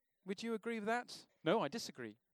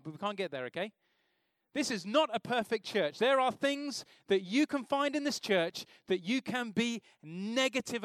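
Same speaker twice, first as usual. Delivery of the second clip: full-force preaching; 5 semitones higher, 7 dB louder than usual. Acted. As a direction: drive the warning home from the pulpit.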